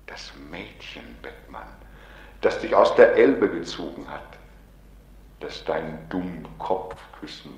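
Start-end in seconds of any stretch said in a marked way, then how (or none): 0.1-1.7 hushed whisper. Acted. The Other hints to Beckmann about the girl, whispered. 2.4-4.4 insistent swell. Acted. The Other insists.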